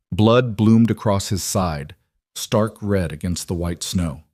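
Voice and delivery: ordinary delivery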